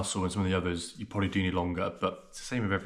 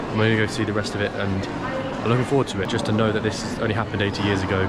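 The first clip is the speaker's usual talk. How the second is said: ordinary talk, nothing marked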